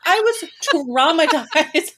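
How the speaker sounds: high, drawn-out exasperated protest; dramatic, high-pitched complaint; comic outrage. Real, not acted. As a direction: stretch it out in laughing indignation. Mock outrage at the prank.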